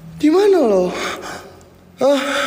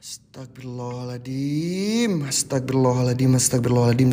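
muttered prayer; 7 semitones lower, 6 dB quieter than usual. Real, not acted. Repeating prayer to calm down.

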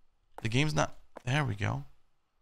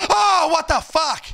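loud, very high shriek of shock; startled scream; 10 dB louder, slower than usual. Real, not acted. Player jumps at a scare mid-game, loud startled scream. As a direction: scream the words out, stretched by real fright. Jump scare while playing.

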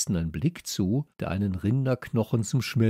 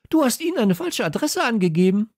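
laut und hoch, fassungslos; aufgebracht; 13 semitones higher, 6 dB louder than usual. A narrator's reading. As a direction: entsetzt vorwerfen. Kalle fassungslos. (laut und fassungslos)

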